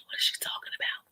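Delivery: voiceless whisper, like a stage aside; sneaky joking whisper; mock whisper. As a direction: whisper it teasingly to the camera.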